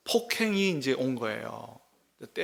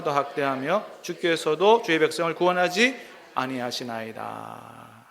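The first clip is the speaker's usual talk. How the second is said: louder, measured Bible reading voice; scripture reading; 5 dB louder than usual. Acted. Pastor reading scripture to the congregation, formal and measured. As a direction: read the verse out to the congregation.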